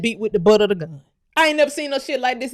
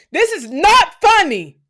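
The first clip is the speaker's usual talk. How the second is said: high, loud, slow "This is not funny" with "not" hit hard; half-laughing protest blown up loud; exasperated outburst; 7 semitones higher, 5 dB louder, slower than usual. Real, not acted. Ranting about mud huts, loud exasperated protest.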